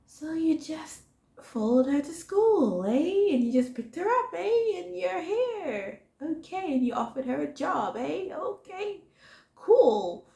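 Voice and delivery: high, incredulous mock questioning; mocking doubtful coworker voice; skeptical second in command. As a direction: raise each eh in disbelief. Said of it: "the same reaction as I did, like".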